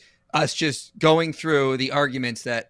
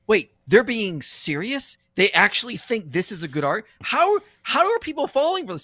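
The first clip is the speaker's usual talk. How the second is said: incredulous; 10 semitones higher, 4 dB louder than usual. Retold or imitated. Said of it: "He's just like".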